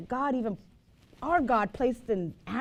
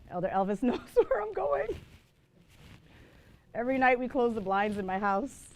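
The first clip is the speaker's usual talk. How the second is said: normal talking voice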